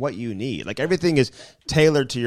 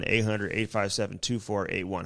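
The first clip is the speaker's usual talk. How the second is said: quieter, lower repeat of the number; softer repeat; 4 semitones lower, 7 dB quieter than usual. Acted. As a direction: read it again, softer and lower. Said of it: "number repeated".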